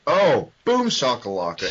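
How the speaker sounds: ordinary talk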